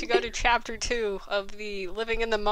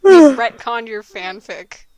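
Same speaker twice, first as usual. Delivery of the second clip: loud mock proclamation; 14 dB louder, slower than usual. Real, not acted. Teasing declaration to co-writer.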